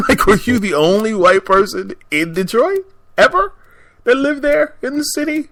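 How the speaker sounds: can't believe what he just said, pitch climbing; incredulous outburst. Retold or imitated. Reacting to the man doubting the DJ is from Detroit, in high loud disbelief. Baffled at stranger dismissing Detroit DJ.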